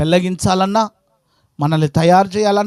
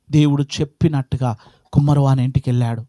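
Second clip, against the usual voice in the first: ordinary talk, nothing marked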